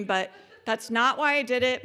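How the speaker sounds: usual speaking voice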